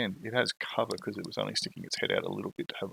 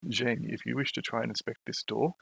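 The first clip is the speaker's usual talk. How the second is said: ordinary delivery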